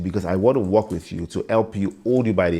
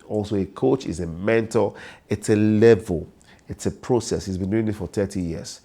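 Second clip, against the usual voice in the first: ordinary talk, nothing marked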